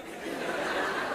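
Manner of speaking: quiet unvoiced laugh; real laugh under the breath; breathy laugh. Real, not acted. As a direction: let a soft breathy laugh slip out. Laugh after joke.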